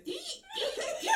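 very high squeaky little moans; mouse squeak moan. Retold or imitated. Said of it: "I've met the mouse who goes there".

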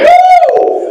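very high shouted whoop; joyful whoop. Real, not acted. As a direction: let out a wild cheer. Preacher's exclamation.

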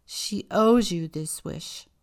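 slow, loud fatherly rebuke; stern father. A narrator's reading. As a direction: lay down the law to his daughter. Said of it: "her father said sternly".

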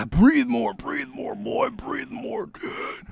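put-on high, fading to a whisper, slowing on boy; mock whispered coaching. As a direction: taunt a panicking kid with useless advice. Mocking advice to drowning kid.

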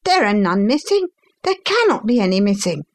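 high-pitched, urgent denial; protesting son. A narrator's reading. Son denying the theft to his father, protesting.

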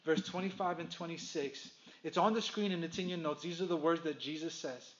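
usual speaking voice

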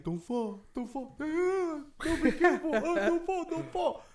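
high, laughing big-brother voice; fussing older kid. Retold or imitated. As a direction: squeak out worried warnings to the little one. Oldest kid holding the youngest.

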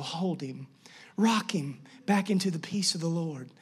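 soft, low lullaby-like murmur; gentle near-whisper, trailing down; hushed soothing. Acted. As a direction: soothe a baby back to sleep. Soothing a baby back to sleep.

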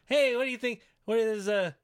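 high, loud teasing impression; quoting CM Punk. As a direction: egg her on with a mock question. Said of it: "He's saying".

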